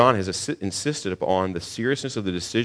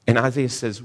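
normal talking voice

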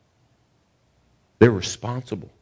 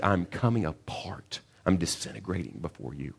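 much softer, slowing, coming apart; fading confession; 4 semitones lower, 9 dB quieter than usual. Acted. Preacher voicing Isaiah's collapse before God, hushed. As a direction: let the voice sink away.

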